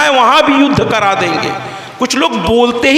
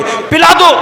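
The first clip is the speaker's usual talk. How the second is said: higher and louder bark; mock gruff answer to a simple question; gruff loud reply; 7 semitones higher, 4 dB louder than usual. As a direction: snap it out like a grump. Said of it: "ये इसी तरह जवाब देंगे".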